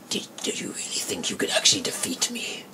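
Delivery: harsh whispered villain taunt; coarse, voiceless Necron menace. Shown as a demonstration. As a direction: whisper it like a menacing robotic overlord. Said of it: "the Necron I did".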